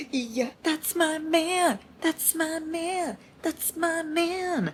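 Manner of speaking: high, slow, soft swoon. Real, not acted. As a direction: melt over him dreamily. Swooning over a fictional man.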